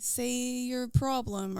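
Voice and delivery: put-on slow, careful American accent for the phone robot. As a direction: spell it out in an American accent for the automated line. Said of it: "I definitely say it in an American accent".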